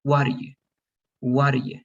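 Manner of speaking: "What are you" run together with a flapped T; contracted, T dropped. Shown as a demonstration. Teacher drilling connected speech, T not pronounced. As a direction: link "What are you" into one word, no hard T. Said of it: "I don't pronounce that T".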